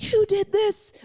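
accusing. Retold or imitated. Angry phone call to husband.

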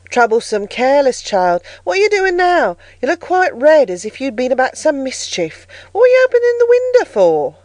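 scolding. A narrator's reading. Nurse Bessie rebuking careless child at the window, scolding. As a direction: scold a naughty child in exasperation.

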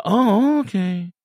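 a high deflated oh, then a drop; disheartened. Retold or imitated. Stalker brushed off, mildly deflated.

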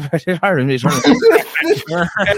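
put-on garbled Irish-accent mumble, high; hammed-up Irish mumble; mock mumbled Irish gibberish. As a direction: mimic unintelligible Irish speech. Mimicking mumbled Irish speech.

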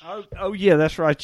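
ordinary talk

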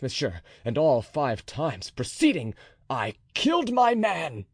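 captain swearing to kill the officer; furious oath. A narrator's reading. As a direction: swear it with mounting rage. D'Artagnan's sworn threat.

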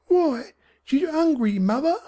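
put-on high-pitched young Cockney boy; boy's voice. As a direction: ask it as a concerned child, pitched high. Boy voiced within narration.

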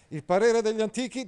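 high, loud pompous knight; Agilulfo pompous. Acted. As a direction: declaim like a stiff courtly knight. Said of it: "disse Agilulfo". (Agilulfo, cavaliere pomposo, acuto e forte)

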